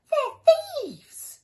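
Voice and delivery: very high, loud indignant cry; outraged neighbour; cross. Acted. Neighbour discovering the robbers, very high and loud. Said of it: "he said crossly".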